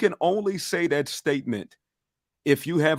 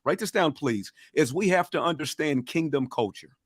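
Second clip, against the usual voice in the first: ordinary delivery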